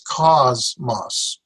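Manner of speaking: "cosmos" slowly split, KOZ then mos; syllable by syllable. Shown as a demonstration. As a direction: divide "cosmos" in two, accent on the first syllable. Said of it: "accent on the first syllable".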